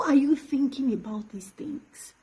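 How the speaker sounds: stunned whisper of disbelief. Real, not acted. To the man who hurt her, whispering in shock. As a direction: breathe it out in disbelief.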